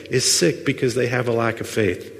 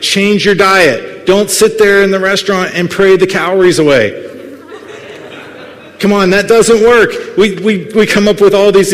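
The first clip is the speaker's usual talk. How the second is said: louder, higher, pressing the point hard; fired-up preacher exhorting the congregation; full-force preaching; 6 semitones higher, 7 dB louder than usual. Acted. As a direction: preach it loud to wake the room.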